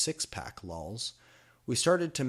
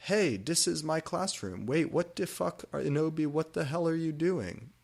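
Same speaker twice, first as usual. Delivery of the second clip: indignant teacher; 8 semitones higher, 3 dB louder than usual. A narrator's reading. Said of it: "Sinister came in".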